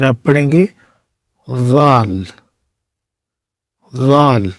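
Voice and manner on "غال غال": غال said as dhal, a voiced th from the teeth edge; Arabic dhal demo. Shown as a demonstration. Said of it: "دانتوں کے کنارے سے ... ٹی ایچ".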